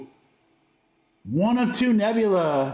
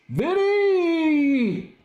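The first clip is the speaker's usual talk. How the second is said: excited shout; 10 semitones higher than usual. Real, not acted. Pulls a hyped rookie card.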